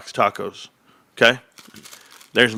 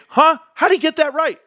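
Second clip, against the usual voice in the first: much higher, louder "Huh?"; mock astonishment; 14 semitones higher, 4 dB louder than usual. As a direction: feign shocked disbelief. Mock amazement at being right.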